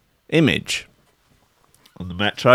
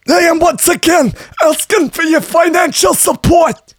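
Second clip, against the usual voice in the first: much higher, shouted old-politician rant in a put-on voice; hammed-up shouting old senator; Bernie Sanders impression. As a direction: bellow it like a fired-up campaign speech. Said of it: "my best Bernie Sanders impression".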